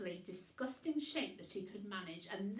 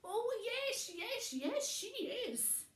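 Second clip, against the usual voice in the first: high, loud eager yes-yes; shouting back; 12 semitones higher, 3 dB louder than usual. Acted. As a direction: shout over your shoulder while fleeing. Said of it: "shouted the man".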